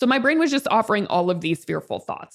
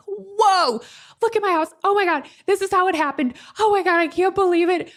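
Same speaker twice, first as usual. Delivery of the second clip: excited gush; 9 semitones higher, faster than usual. Retold or imitated. Telling everyone about the new house, high excited gush. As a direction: squeal over the new house to friends. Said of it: "I was like".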